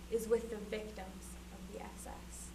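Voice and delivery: a grave whispered accusation; hushed whisper. Acted. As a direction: drop to a whisper. Solemn speech, sudden hushed line.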